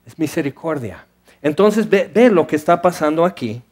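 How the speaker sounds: ordinary delivery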